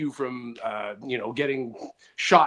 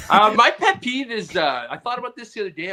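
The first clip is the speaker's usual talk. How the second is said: ordinary delivery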